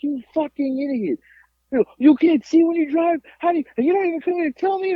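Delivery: high-pitched mock tirade; imitated angry dad. Father berating son who can't see when driving, high mock fury. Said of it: "he was so mad at me".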